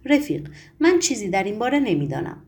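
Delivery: ordinary delivery, nothing marked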